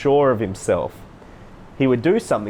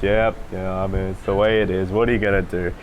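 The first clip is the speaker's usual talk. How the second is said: listless, low-energy monotone; drained mumbly indifference; flat monotone; 4 semitones lower than usual. Shown as a demonstration. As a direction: sound like you can't be bothered. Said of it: "start to be monotone".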